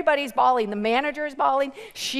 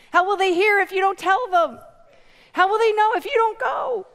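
high, loud rhetorical challenge; rousing pulpit appeal; impassioned preaching; 7 semitones higher, 6 dB louder than usual. Real, not acted. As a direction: press each question on the congregation. Preacher presses the congregation.

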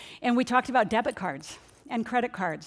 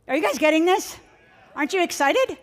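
higher and louder, rising to pump up the crowd; excited; 7 semitones higher, 4 dB louder than usual. Real, not acted. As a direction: lift the room with a bright question. Hyping up the seminar audience.

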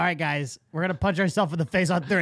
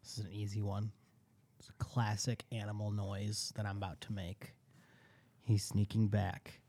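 whisper; 8 semitones lower, 13 dB quieter than usual. Real, not acted. Whispers secretly near the mic.

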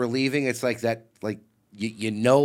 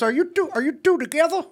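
imitating Tony Atlas; 14 semitones higher than usual. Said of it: "He goes".

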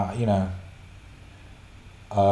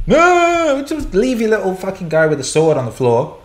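mock angry brother; 8 semitones higher, 4 dB louder than usual. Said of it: "everyone's just pissed off at me".

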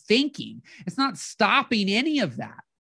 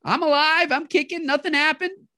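calming yourself down; 7 semitones higher than usual. Retold or imitated. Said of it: "You can go".

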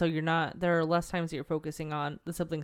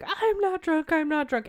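high, louder mock-drunk denial; drunk mother protesting; 11 semitones higher, 5 dB louder than usual. Retelling a drunk mother to her son, mock protest. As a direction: play a tipsy mom insisting, pitched way up. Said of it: "of her being like".

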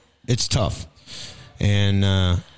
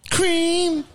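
silly squeal. Acted. Silly squeal about sandwich cream.

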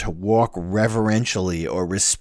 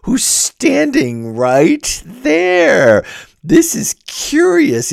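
mock surprise; 5 dB louder, much slower than usual. Real, not acted. Pointing out Virgil's hidden presence.

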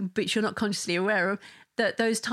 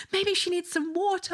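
high, eager mock of husband; anxious helpful husband; 11 semitones higher than usual. Wife retells husband rushing with water, high eager. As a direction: voice the fussing dad offering water.

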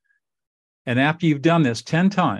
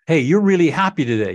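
higher, louder friendly observation; upbeat greeting; 5 semitones higher, 6 dB louder than usual. Shown as a demonstration. Coach demonstrating what clients say to the barista, upbeat. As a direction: pitch it up brightly, as if to a stranger.

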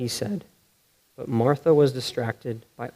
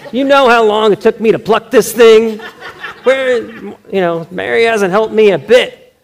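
mock indignant Martha; 8 semitones higher, 7 dB louder than usual. Martha voiced shaking the duck.

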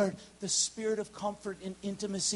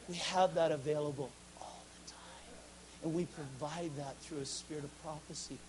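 much softer, whispered comfort; hushed whisper; 12 dB quieter than usual. Real, not acted. Preacher speaks God's comfort to a girl, hushed whisper. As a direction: whisper it close and tender. Preacher drops to intimate hush.

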